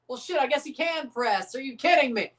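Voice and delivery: ordinary delivery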